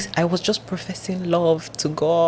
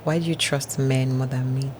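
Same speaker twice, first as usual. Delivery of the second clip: a softer, hushed voice of God asking; God's quiet voice; 10 dB quieter than usual. Retold or imitated. God's voice quoted.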